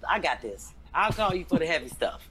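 normal talking voice

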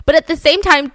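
ordinary delivery, nothing marked

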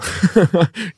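high, awkward burst of giggles; nervous laugh. Shown as a demonstration. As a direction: laugh to cover not understanding. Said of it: "I nervous laugh pretty bad".

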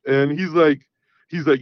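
normal talking voice

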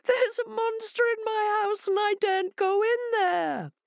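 very high, squeaky wail; crying hare. Acted. Hare tells Jackal of the monster, crying. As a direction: whimper it high as the distressed hare. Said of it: "cried Hare".

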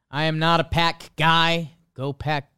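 ordinary talk, nothing marked

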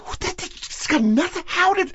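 high, sputtering disbelief; acted bewilderment at a failed joke; incredulous. Reenacts disbelief when proven joke fails.

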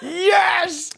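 high, drawn-out gleeful yes; delighted cheer. Real, not acted. Co-host hears kids egged his house, high delighted cheer. Co-host revels in egging story.

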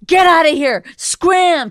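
high, loud snap to shoo someone off; mock dismissive shout. Wife imitating husband shooing the cat, mock angry shout. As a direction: bark at the pets to clear out. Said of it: "you'll be like".

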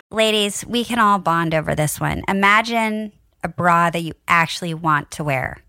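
loud, upbeat sponsor pitch; ad read. Acted. Podcast host reading a bra ad, bright and loud. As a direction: sell it to the women listening.

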